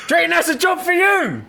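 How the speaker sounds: high, sarcastic sing-song repeat; mocking disbelief. Mocking repeat after a laugh.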